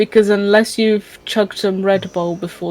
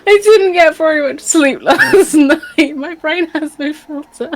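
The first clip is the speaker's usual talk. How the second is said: giggling through the words; laughing; 8 semitones higher, 6 dB louder than usual. Real, not acted. Tired streamer giggles at slip.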